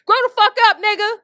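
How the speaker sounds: high, loud yell; yelled dismissal. Real, not acted. Telling off a grown man in the comments, high yell. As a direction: shout it down at him. Ranting at men online.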